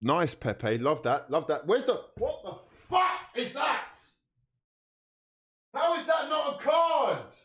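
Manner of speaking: high, slow, furious yell at the referee; outraged shout. Real, not acted. Fan yelling at the ref over a missed card, outraged shout. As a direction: bellow the disbelief.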